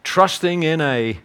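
low, soft, measured preaching; solemn sermon close. Real, not acted. Preacher closing on simple trust in Christ, softly and solemnly. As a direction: let it settle quietly, pausing between phrases.

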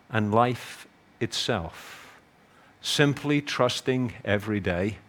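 low, soft, measured preaching; solemn sermon close. Real, not acted. Preacher closing on simple trust in Christ, softly and solemnly. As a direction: let it settle quietly, pausing between phrases.

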